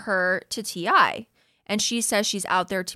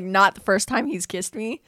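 usual speaking voice

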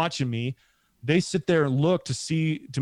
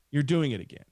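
ordinary delivery, nothing marked

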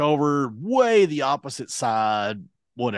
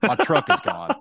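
quicker, slightly raised blurt; retold urgent news; 3 semitones higher than usual. Stranded man interrupting friend on phone, urgent blurt.

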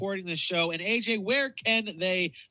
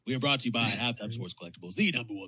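lowered silly sponsor-read voice; put-on voice; 4 semitones lower than usual. Host thanking the show's sponsor, low put-on voice. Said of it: "What kind of voices are these?".

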